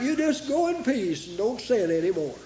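soft, low and gentle, as Jesus pardoning; gentle forgiveness. Acted. Jesus sends the forgiven woman away gently. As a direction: let the forgiveness land quietly.

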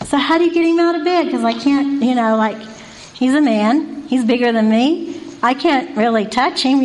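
normal talking voice

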